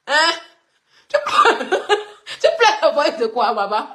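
teasing through real laughter; laughing. Real, not acted. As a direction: crack up while mocking the complainers. Cracks up teasing complainers.

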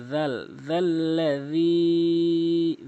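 জাল্লাজি with dh from tongue at teeth, slow; soft dh sound. Shown as a demonstration. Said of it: "জিহ্বার আগে দাঁতের আগে".

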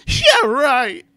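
high, loud exclamation; hammed-up high outburst; exclaimed. A narrator's reading. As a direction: squawk it out, overblown. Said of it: "she exclaimed".

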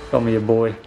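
usual speaking voice

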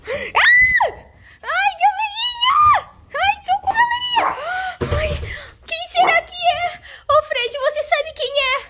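high panicked screaming; being chased. Acted. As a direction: scream for help while fleeing.

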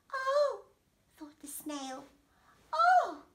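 high-pitched snail voice; hurt little snail. Acted. Snail taken aback by the rude horse, high voice. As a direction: squeak out a dismayed little "oh".

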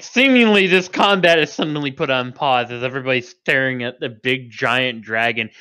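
usual speaking voice

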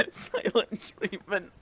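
normal talking voice

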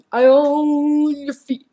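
slow, high, put-on creepy voice; creepy taunt. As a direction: drag out own like a villain. Taunting a caught player.

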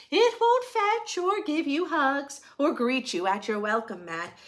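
plain reading voice

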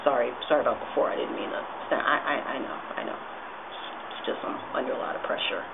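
low, soft apology; subdued apology. Acted. Performer apologising under pressure, low subdued voice. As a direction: drop the voice and make peace.

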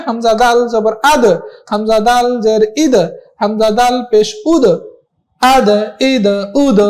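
spelling drill, আদ, ইদ, উদ each with a bounced final d; measured drill with a kick after each final d; qalqalah bounce. Shown as a demonstration. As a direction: push the d at the end of আদ, ইদ, উদ into a short echo. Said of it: "একটু ধাক্কা দিয়ে পড়া".

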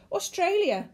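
put-on high, loud boyish shout; excited boy calling out; exclaimed. A narrator's reading. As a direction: blurt it out eagerly. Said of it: "the boy exclaimed".

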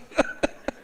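short wordless chuckle; brief laugh at the irony. Real, not acted. Laughs after mocking pretended weeping.

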